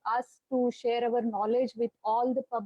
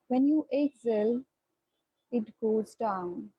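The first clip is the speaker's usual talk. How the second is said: guided meditation. Acted. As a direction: ease them into their breath, unhurried. Leads a guided breathing meditation.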